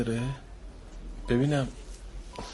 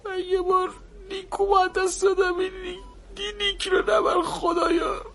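higher, softer, sobbing; crying prayer; 7 semitones higher, 4 dB quieter than usual. Acted. Father begging God not to take the child, crying. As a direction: pray through tears.

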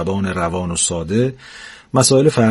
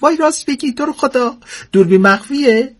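much higher, squealing delight; thrilled old woman. Acted. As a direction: squeal with giddy joy. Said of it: "ذوق کرد".

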